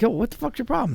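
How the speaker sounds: squeaky annoyed "what's your problem"; high-pitched exasperated retort. Retold or imitated. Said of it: "I'm like".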